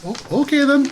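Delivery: high, innocent character voice; simple character going along with it; Glub's acceptance. Acted. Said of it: "looks at it and goes".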